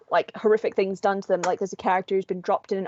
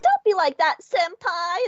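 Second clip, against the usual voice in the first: much higher, slow, screeching anime fangirl; 11 semitones higher, 4 dB louder, slower than usual. Retold or imitated. Embarrassing a friend at a convention, high screeching fangirl. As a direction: shriek it across the hall to embarrass a friend. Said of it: "high-pitched screeching fangirl".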